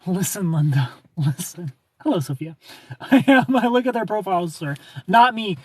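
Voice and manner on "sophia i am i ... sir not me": usual speaking voice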